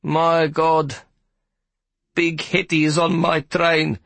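high, slow voice on the verge of crying; near tears. Acted. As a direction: despair as if about to cry. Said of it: "He sounded as if he were about to cry".

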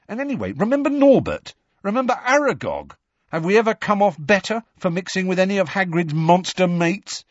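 high, insistent, exasperated questions; exasperated argument. A narrator's reading. Ron reminds friends of Hagrid's past monsters, exasperated. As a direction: press the point with mounting exasperation.

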